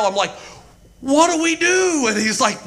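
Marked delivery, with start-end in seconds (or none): 1.0-2.1 hammed-up bewilderment; exasperated plea. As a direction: wail it helplessly, played for laughs. Repeats exasperated retold question.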